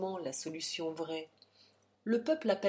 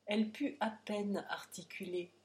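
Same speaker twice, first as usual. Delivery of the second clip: plain reading voice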